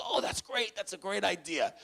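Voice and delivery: high, eager delight at the offer. Retold or imitated. Student accepting the staffer's idea, delighted. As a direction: brighten up with a high-pitched lift.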